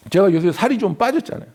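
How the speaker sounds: ordinary talk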